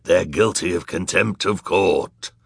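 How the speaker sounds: hoarse villainous judge passing judgement. A narrator's reading. Villainous man accusing the children of contempt, hoarse voice. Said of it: "in his hoarse voice".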